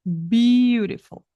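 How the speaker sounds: "beautiful" slow with BEAU punched; stress the first syllable. Shown as a demonstration. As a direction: lean hard on BEAU. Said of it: "ponha a sílaba a primeira mais forte".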